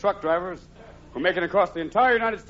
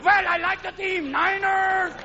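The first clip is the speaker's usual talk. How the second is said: Niner fever shout; 11 semitones higher, slower than usual. Retold or imitated. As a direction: yell it like a crazed football fan. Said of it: "Professor Hibble".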